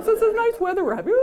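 high, quick, laughing chit-chat to cover the tug; breezy fake politeness while pulling back; awkward polite small talk. To a stranger gripping the same pashmina, high, laughing, fake-casual chatter.

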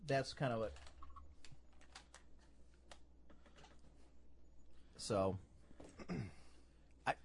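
mic cutting out, dropping away; words fading in and out of the mic; voice going in and out. Real, not acted. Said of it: "my voice is going in and out here".

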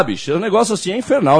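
ordinary delivery

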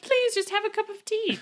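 put-on high sweet pleading old woman; old lady voice. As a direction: coax like a doting granny. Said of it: "she's like".

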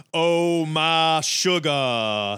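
hammed-up Southern accent; slow, drawn-out mock Southern drawl; Gambit. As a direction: stretch it into a bad Southern accent. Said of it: "The Southern accent they write for him".

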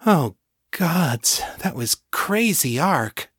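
dazed but content. A narrator's reading. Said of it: "sounding dazed but content".

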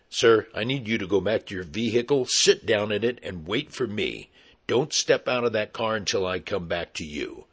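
plain reading voice

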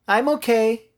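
put-on high childish reassurance; little girl calling back. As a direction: answer Dad through the door like a small kid. Girl calls back to Daddy.

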